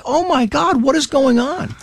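high-pitched exasperated disbelief; mock dismay. Eight-year-old appalled by endless drills, mock dismay. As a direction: pitch it right up, comically appalled. Child appalled by practice without footballs.

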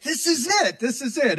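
eager, high quoted exclamation, easing slightly on the repeat; excited reaction. Retold or imitated. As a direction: pitch it up high, as if reliving a discovery. Retelling excited reaction to posted video.

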